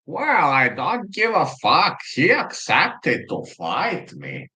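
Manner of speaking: slow, high put-on fighter voice; Strickland impression. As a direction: drawl it like a cocky fighter. Quoting Strickland's reply.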